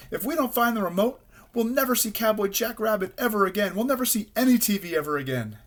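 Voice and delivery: high, despairing wail of words; panicked. A narrator's reading. Rabbit despairs over lost remote.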